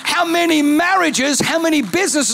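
shouting pastor in full flight; preaching at full force. Acted. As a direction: preach to the climax. Preaching climax.

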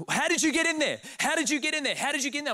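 high-pitched, rapid-fire demand; mock irate yelling. Old Italian guy confronting intruders, mock irate. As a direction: imitate an upset man's insistent questioning. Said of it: "He's yelling at me".

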